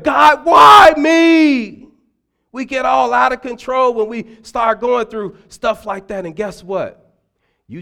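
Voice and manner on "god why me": high, loud, slow anguished cry to heaven; panicked wail. Acted. Preacher mocking a panicking believer, loud anguished wail. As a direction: wail it out like your hair is on fire.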